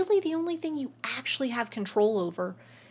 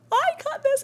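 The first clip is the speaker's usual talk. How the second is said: much higher, bubbly delight. Real, not acted. Vlogger gushing to viewers about her new haircut. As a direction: show off happily. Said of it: "I just cut this hair".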